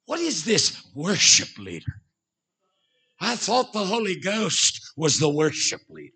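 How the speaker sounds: lightly imitated Welsh accent; [name]. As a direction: question it like a Welsh preacher, in a mock Welsh accent. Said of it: "in that Welsh accent".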